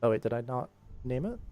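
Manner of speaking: usual speaking voice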